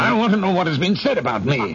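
high-pitched, insistent outburst; angry demand. Acted. Husband twisting wife's arm for answers, angry high demand. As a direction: force the answer out of her.

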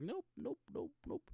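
chirpy high-pitched denial, like dodging the question; high squeaky nopes. Pretend. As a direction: squeak each nope way up high, playfully refusing. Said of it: "Literally just be like".

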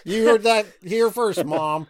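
loud, high-pitched playful call-out; mock announcement. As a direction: call it out to Mom, loud and joking. Joking call-out to his mom.

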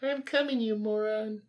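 very high mocking mom; shrieky mom voice. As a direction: play the annoyed mom.

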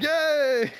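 high excited yay; mock cheer. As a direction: cheer like a happy crowd. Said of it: "people are like".

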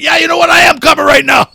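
very loud, high mock yell; shouted threat, acted out; retold furious shout. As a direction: imitate an enraged friend on a call. Retold furious phone call.

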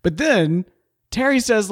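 ordinary delivery

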